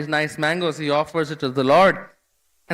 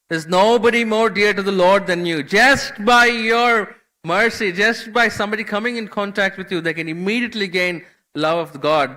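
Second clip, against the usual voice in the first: gushing praise, higher and louder, stretched out; effusive flattery; 6 semitones higher, 5 dB louder than usual. Retold or imitated. Kalidas gushing praise at Jadu Thakur to win mangoes, raised and loud. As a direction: lay the flattery on thick, swelling up.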